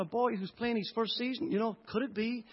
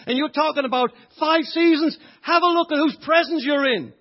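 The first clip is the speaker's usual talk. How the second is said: indignant reply; 6 semitones higher, 9 dB louder than usual. Retold or imitated. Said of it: "And I could have said".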